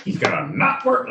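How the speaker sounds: high-pitched mimicry of co-host; high mock echo; mocking co-host's repeat. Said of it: "you make it sound like".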